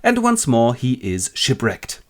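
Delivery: ordinary delivery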